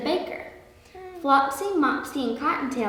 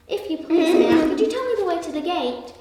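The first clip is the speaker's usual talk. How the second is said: higher-pitched pleading little rabbit; earnest, higher request for directions; polite asking; 6 semitones higher, 4 dB louder than usual. A narrator's reading. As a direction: ask politely in a small storybook voice. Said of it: "he asked".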